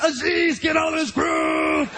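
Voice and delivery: high, drawn-out mock yell; all-caps shout. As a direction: stretch the last word in hammed-up fury. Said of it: "He writes in all caps".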